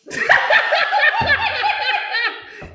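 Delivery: loud high-pitched laugh. Real, not acted. Big laugh.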